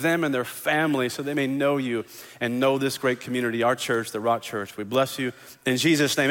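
soft, earnest prayer voice. Acted. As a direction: pray it quietly and sincerely. Pastor leads the congregation in prayer.

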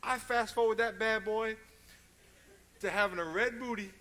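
loud, high-pitched telling; loud exclaim. Real, not acted. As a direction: belt it out to the congregation. Preacher exclaims retold punishment.

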